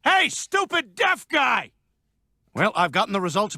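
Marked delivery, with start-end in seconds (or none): 0.0-1.7 much higher, slow, shouted. Acted. Doctor yelling at the patient to test his hearing. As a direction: yell it out to test his ears.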